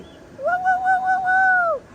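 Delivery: very high, playful falsetto woo; squealing party hoots while dancing. Pretend. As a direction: hoot it up high like a party cheer. Hooting while dancing on stream.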